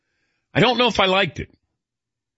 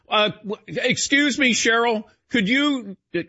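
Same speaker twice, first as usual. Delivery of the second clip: mock eager passenger; 7 semitones higher than usual. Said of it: "I would have taken, like, wait".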